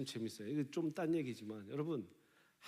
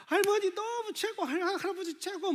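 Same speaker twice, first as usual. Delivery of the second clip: put-on much higher, louder childish glee; excited grandchild; 4 dB louder than usual. Grandchild thanking grandparents for a gift, high childish glee. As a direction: squeal like a thrilled grandkid. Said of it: "막 이런 손주가".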